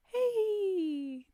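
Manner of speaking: high, loud delighted cry; excited. Real, not acted. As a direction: exclaim in sudden delight. Delighted at the story twist.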